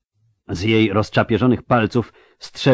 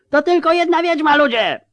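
very high, ringing metallic shout; a piercing agitator calling from the crowd. Acted. A voice from the crowd rouses people against the witch, ringing and metallic. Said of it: "dźwięczny i metaliczny głos".

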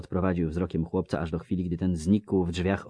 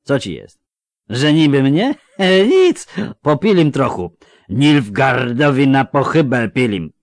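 loud, higher, slurring tipsy villager; drunk Melfi; 8 semitones higher, 13 dB louder than usual. Acted. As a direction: call out like a cheery drunk. (pijany Melfi, głośno i bełkotliwie)

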